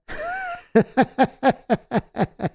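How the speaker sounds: a high, breathy burst of laughter; amused laugh at a chat comment. Real, not acted. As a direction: laugh it off lightly.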